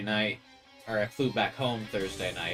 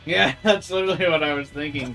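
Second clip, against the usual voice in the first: laughing; 5 semitones higher, 10 dB louder than usual. Real, not acted. Chat guessed his thought.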